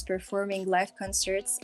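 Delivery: normal talking voice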